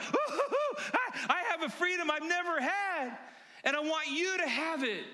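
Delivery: high squealed oohs, then elated cry; giddy, high-pitched jubilation; overjoyed woman. Acted. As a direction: whoop high, then proclaim new freedom. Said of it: "She's running around like".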